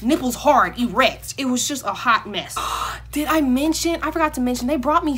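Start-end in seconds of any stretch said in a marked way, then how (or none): 2.6-3.1 sharp intake of breath on remembering; sudden gasp. Real, not acted. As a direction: gasp as if you just remembered.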